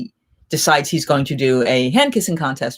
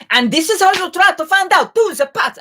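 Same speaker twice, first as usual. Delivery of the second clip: high-pitched Italian tirade, louder; angry yelling; 14 semitones higher, 5 dB louder than usual. Retold or imitated. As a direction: re-enact yelling furious Italian phrases at someone. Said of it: "I just start yelling at him".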